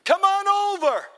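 very high, loud, stretched-out call; long shouted summons; acted yell back. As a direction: holler it back across the distance. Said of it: "And they yelled out".

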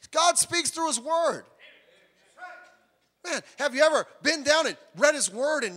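high, loud preacher's cry; fervent sermon peak; impassioned preaching. Real, not acted. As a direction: drive the point home from the pulpit. Preaching climax.

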